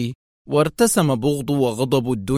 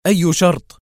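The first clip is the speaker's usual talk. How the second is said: edgy, louder and higher; nervous question; 5 semitones higher, 5 dB louder than usual. A narrator's reading. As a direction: ask it impatiently, on edge. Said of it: "سأله كواليسكي في عصبية".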